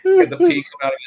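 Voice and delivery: high squeaky panic; hammed-up high-pitched dread; panicked Kafka. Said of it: "He's like".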